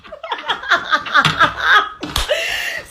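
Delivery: long high cackling laugh; high cackle. Real, not acted. Laughing at her ex, a long high cackle. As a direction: cackle at him.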